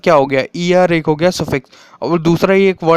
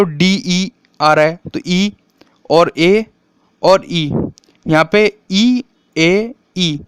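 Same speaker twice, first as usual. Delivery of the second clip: slow, separated vowel names ई, ए, ई; slow counting out; much slower than usual. Shown as a demonstration. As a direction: count each vowel out one by one for students. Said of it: "counts vowels slowly".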